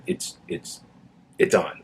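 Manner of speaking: hushed, breathy warning mimicked; imitating a whisper. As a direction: whisper it like a nervous colleague. Officer warns camera is on.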